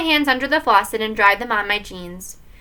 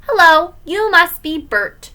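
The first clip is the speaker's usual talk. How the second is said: put-on slow, high, loud kid's puppet voice; drawn-out, higher children's-TV greeting; Sesame Street voice; 7 semitones higher, 6 dB louder than usual. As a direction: play Ernie like a little boy. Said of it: "in his best Sesame Street voice".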